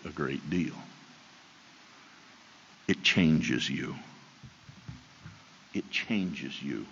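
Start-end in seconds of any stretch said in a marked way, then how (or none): 2.9-6.9 slow hushed emphasis. Acted. Repeated key point.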